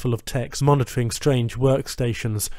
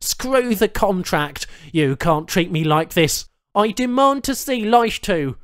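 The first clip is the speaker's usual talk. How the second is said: high, loud, furious demands; strapped patient yelling at the tech; angry outburst; 7 semitones higher, 3 dB louder than usual. A narrator's reading. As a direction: rage against the restraints. Charles angrily demands.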